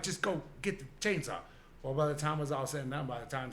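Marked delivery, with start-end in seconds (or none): 0.0-1.4 raised, higher mock demand, pushing her to hurry; fed up with his girlfriend, he repeats the order, louder and higher; impatient order. A mock-exasperated command. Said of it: "I'm like".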